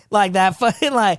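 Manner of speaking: ordinary talk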